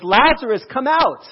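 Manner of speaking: calling a dead man from the tomb, full voice; high, loud command that rings out. Acted. Jesus summoning Lazarus from the tomb, a loud call. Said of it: "Jesus called out in a loud voice".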